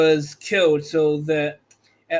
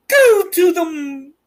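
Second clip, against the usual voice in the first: wicked witch voice; 12 semitones higher, 6 dB louder than usual. A narrator's reading. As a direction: shriek the order like a wicked witch. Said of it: "said the witch".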